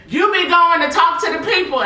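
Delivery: high, loud imitating grandma; grandma quote. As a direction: mimic the grandma lovingly. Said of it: "And she said".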